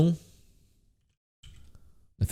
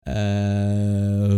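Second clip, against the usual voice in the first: ordinary talk, nothing marked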